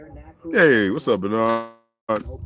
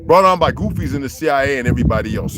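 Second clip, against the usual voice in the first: usual speaking voice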